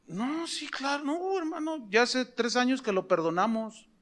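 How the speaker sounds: high, soft, kind reassurance; tender forgiving reply; gentle forgiveness. Retold or imitated. Forgiving the pastor.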